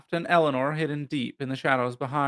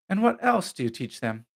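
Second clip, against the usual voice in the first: higher, courteous inquiry; polite question; 6 semitones higher than usual. A narrator's reading. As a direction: ask politely with a lifted pitch. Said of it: "Theodora asked politely".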